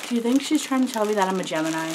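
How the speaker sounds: a fast, loud burst of joy; excited, loud and fast. Real, not acted. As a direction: rattle it off thrilled and loud. Delighted by a Gemini gift.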